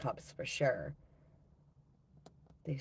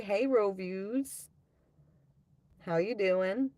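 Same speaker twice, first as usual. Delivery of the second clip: ordinary talk, nothing marked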